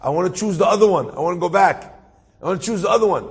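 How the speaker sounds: imitating a man begging an angel; retold begging. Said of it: "I started begging the angel".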